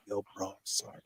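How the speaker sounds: low, soft voiceless whisper. Retold or imitated. Brother's voice in the dream apologizing, whispered. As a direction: let it float in like a ghost voice. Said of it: "I heard it as clear as day".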